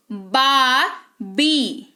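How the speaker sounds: "बाबी" split into a long "baa", pause, long "bee"; "बाबी" as two drawn-out, separate efforts; two separate syllables. Shown as a demonstration. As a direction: break "बाबी" in two, very slowly. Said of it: "ये सारे दो syllable हैं".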